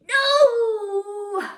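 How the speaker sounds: long, high, loud wail; dismayed cry. Real, not acted. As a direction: wail out after fumbling the tongue twister. Fumbles the tongue twister.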